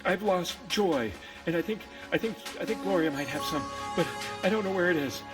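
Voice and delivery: puffing hard between short phrases; winded from running all day; breathless, urgent delivery; out of breath. Acted. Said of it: "I'm out of breath".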